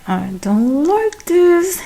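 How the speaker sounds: slow, high, drawn-out reluctance; mock uncomfortable protest. Lauren made to sit on his lap, squeamish protest. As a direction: stretch it out high, acting squeamish.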